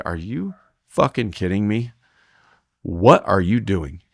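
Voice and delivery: slow, drawn-out incredulous scolding; exasperated disbelief. Retold or imitated. Roper confronting partner out the back end, exasperated. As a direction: stretch each word in disbelief. Retold scolding after the miss.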